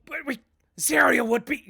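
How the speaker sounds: high, loud protest; whiny shout. Acted.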